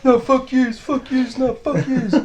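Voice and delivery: very high, loud mock yell, laughing at the end; drunk defiant shout. Teller mocking the beaten guy on the ground, high defiant yell. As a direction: ham up the floored guy's slurred defiance. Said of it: "Sitting there".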